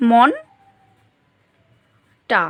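"মন টা" with a long pause between; syllable by syllable. Shown as a demonstration. Teacher writing out the example, "মন টা" syllable by syllable. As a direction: leave a wide gap after "মন". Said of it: "লিখছি এখানে ধরো".

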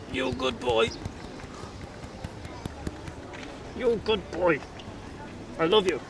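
high, soft cooing to a pet; pet talk. Real, not acted. Praising his cat Moon Pie.

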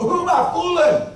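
self-mocking. Real, not acted. Preacher laughing at his own age, high incredulous question. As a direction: ask it like you can't believe yourself.

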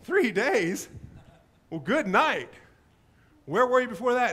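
high, loud incredulous question; can't believe what you just heard; stunned disbelief. Retold or imitated. Stunned reaction to three days.

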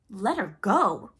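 loud, drawn-out disbelieving cry; outraged cry. A narrator's reading. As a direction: echo it in slow outrage. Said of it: "cried Hook".

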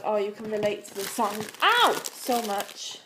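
Craig's line, stretched out on ow, so much; imitating Craig. Retells Craig's reaction to the song.

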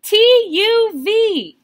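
very loud, very high letters; exclamation-mark reading at full excitement; excited exclamation. Shown as a demonstration. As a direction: shout them out thrilled. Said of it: "more exclamation marks".

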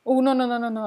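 high, loud panicked no, no, no; alarmed cry. Real, not acted. Gamer panicking after pushing the cat off, high and loud. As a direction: panic at the mistake.

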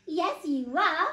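put-on high, loud child retort; gleeful little boy yelp. A narrator's reading. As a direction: yelp it back, drawn out. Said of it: "yelped Eddie".

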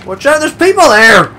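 high, loud panicked warning; alarmed shout. Real, not acted. Driving badly toward pedestrians, real alarmed yell.

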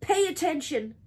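high, loud urgent order; screaming. A narrator's reading. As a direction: yell it to rouse a sleeper. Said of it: "screamed Wilbur".

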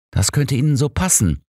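slightly irritated. A narrator's reading. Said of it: "sagte ich leicht gereizt".

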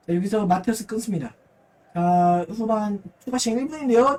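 normal talking voice

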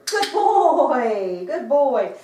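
loud, gushing praise falling from high; excited praise. Real, not acted. Trainer rewarding her dog for a shake, excited praise. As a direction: reward the dog with big, drawn-out delight.

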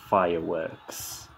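usual speaking voice

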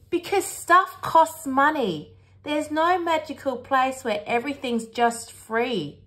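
high, emphatic explaining mother; exasperated Mum. Acted. Voices Mum explaining to the children.

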